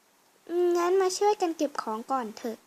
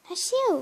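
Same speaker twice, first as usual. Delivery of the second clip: sneeze; 6 semitones higher than usual. Acted. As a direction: sneeze out hard. Sneezes, then asked if sick. (จามเสียงดัง)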